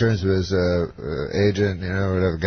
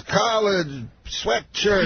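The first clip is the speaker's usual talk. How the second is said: higher, Cosby talking in bare words; just two words, Cosby-style; Cosby impression; 9 semitones higher, much slower than usual. Explaining his outfit, mock Cosby two-word answer. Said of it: "he talks, like, just in words".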